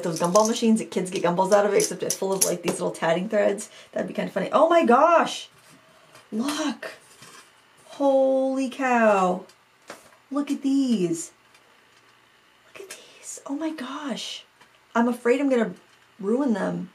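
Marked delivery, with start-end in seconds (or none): 4.5-6.9 real amazement. Real, not acted. Amazed at finding tiny lace. 7.9-11.3 slow, drawn-out wonder, pitched up; awed amazement. Real, not acted. Awed by the tatted pieces. 12.7-14.4 real hushed, whispered amazement; awed whisper. Real, not acted. Whispers in awe at the find.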